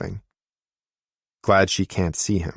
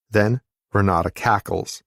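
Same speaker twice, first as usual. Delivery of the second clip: ordinary delivery, nothing marked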